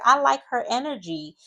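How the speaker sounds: lifted, high admiring voice; gushing imitation. Ariana approving of Georgia, high-pitched gushing. Ariana gushes in confessional, retold.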